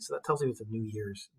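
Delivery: ordinary talk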